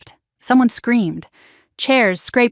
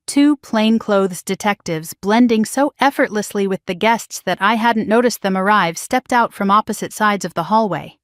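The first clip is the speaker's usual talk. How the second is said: ordinary delivery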